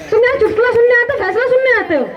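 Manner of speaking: high, fast, indignant scolding; angry rebuke. Acted. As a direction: snap at him like a stern judge. Judge scolding a joking man. (अदालत में मज़ाक करने वाले को ऊँची तेज़ आवाज़ में डाँटना)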